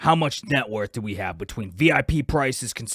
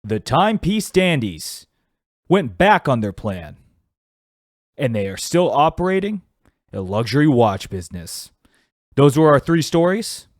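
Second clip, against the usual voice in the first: narrator outro; 6 dB louder than usual. Acted. Host wraps up the skit as narrator. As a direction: close the story like a narrator.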